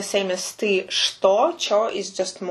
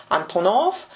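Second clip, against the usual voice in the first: Antonov stressed on the final -nov; stress on last syllable. Shown as a demonstration. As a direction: say Antonov as anto-NOV, lifting at the end. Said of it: "so the stress is in the middle".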